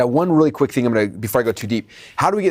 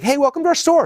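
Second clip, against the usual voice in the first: high, eager shop greeting; cheerful salesperson; 13 semitones higher than usual. Acted. Salesperson welcoming a customer at the door, high and cheerful. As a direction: greet him like the happiest clerk alive.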